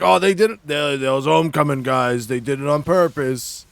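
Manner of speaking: mocking thick Boston accent. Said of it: "He's giving a Boston accent".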